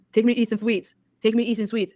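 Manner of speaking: a lightly voiced copy of the man on the call; imitating his voice. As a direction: mimic how he talked to his phone. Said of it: "That's how he sounds".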